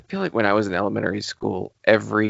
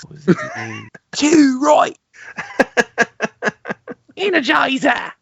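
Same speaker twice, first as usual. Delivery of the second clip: mock Australian accent. Cohost mocking an eighties Energizer spokesman, hammed-up Australian accent. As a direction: ham up an Aussie bodybuilder, cracking up. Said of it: "deepest apologies to any Australian listeners".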